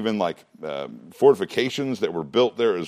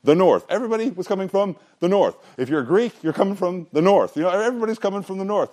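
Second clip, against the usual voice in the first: higher and faster with each line, piling it on; building excitement; 7 semitones higher than usual. Real, not acted. Teacher driving home the north to his class, climbing excitement. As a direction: keep repeating it, getting more worked up.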